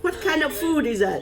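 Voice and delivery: laughing question. Real, not acted. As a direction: ask it while laughing. Joke about food ready.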